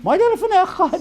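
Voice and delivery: high, rote parrot recitation; parrot-like mumble. Retold or imitated. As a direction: gabble it like a parrot. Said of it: "how many wake up in the morning".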